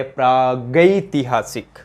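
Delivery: प्रागैतिहासिक drawn out slowly, praa-gai-ti-haa-sik; slow careful pronunciation. Shown as a demonstration. Teacher to students, slowly spelling out the correct word. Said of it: "प्रागैतिहासिक होता है".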